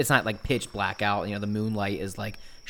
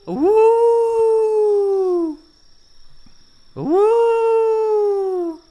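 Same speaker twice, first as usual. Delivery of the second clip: very high, loud hooting call; owl hoot call; 11 dB louder than usual. Acted. DM narrates sound from woods.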